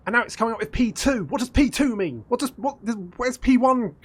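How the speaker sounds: rising outrage at a cryptic error code; exasperated rant. Real, not acted. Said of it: "I'm so angry right now".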